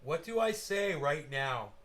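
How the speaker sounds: usual speaking voice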